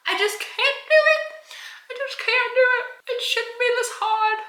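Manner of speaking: very high, squeaky pretend wail; mock despair. Customer defeated by a simple appointment, mock despair. Mock breakdown over scheduling.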